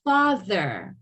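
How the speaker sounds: Father with lips apart; teacher models Father; voiced TH demo. Shown as a demonstration. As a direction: buzz the TH in Father. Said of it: "My lips are further away".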